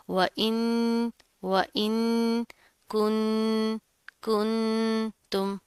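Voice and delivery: वइन with a long hummed n; held nasal ghunnah. Shown as a demonstration. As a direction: slowly hold each n in कुंतुम through the nose. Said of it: "हम गुन्ना करेंगे". (वइन, कुंतुम में लंबा गुन्ना)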